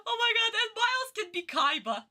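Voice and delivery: high squeal on the edge of hysterics; laughing excitement. Real, not acted. Streamer adding another casting, giddy laughing squeal. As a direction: blurt the idea through rising laughter.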